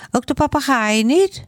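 put-on high child's voice, doubtful question; curious child. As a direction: ask it like a puzzled little boy. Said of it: "vraagt Hans". (hoge kinderstem, twijfelend)

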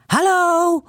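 put-on a high, loud child's call into the stethoscope; shouting hard. A narrator's reading. Little girl yelling into the doctor's stethoscope, loud and high. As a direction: yell hello right into it. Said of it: "roept ze heel hard".

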